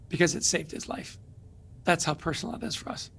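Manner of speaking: normal talking voice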